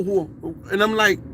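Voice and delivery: usual speaking voice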